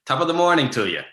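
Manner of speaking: tossing off the classic Irish greeting; Irish accent. Shown as a demonstration. Asked for an Irish accent, a light Irish lilt. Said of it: "Can you say something in an Irish accent?".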